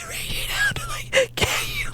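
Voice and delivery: high, soft wheeze with words barely getting out; helpless laughter. Retold or imitated. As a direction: try to talk while stifling hard laughter. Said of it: "I'm laughing so hard".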